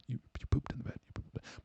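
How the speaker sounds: barely voiced, tender whisper; gentle telling. Boyfriend breaks news to confused girlfriend, whispering gently. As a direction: whisper the bad news softly. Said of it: "Part of the problem was you accosting her".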